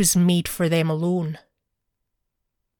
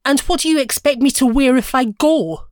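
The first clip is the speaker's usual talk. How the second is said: higher, louder, impatient demand; 5 semitones higher, 5 dB louder than usual. A narrator's reading. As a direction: snap the question at him. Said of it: "said impatiently".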